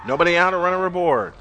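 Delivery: normal talking voice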